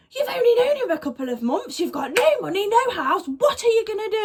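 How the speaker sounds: high-pitched mock rant; hammed-up furious telling-off; livid mum imitation. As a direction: imitate an irate mum laying into her. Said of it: "Anyway, she was livid".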